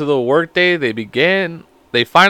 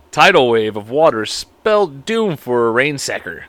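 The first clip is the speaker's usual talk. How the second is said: ordinary delivery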